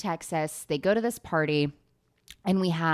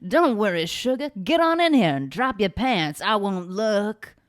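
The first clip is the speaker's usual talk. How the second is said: sultry southern belle; 3 semitones higher, 5 dB louder than usual. Acted. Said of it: "Margo goes".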